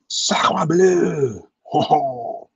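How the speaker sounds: high, loud mock French cry; hammed-up French. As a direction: exclaim it like a cartoon Frenchman. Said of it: "Why am I doing that sound?".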